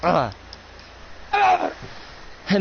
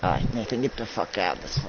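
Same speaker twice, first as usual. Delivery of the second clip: low menacing order; 3 semitones lower, 7 dB quieter, faster than usual. Acted. Ordering Nathan out.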